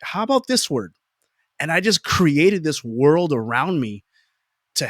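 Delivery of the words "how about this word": mock innocent question. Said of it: "I'd be like".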